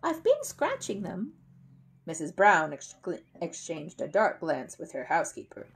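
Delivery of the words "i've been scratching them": higher, slow, hopeful excuse. A narrator's reading. Paddington explaining loose spots to Mrs Bird, hopeful. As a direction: add it helpfully, hoping to be believed. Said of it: "said Paddington hopefully".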